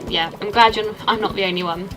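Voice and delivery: ordinary talk, nothing marked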